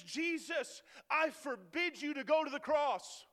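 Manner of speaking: loud, forceful command; Peter forbidding. Retold or imitated. Peter confronting Jesus, forceful and commanding. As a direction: forbid it outright. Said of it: "he says".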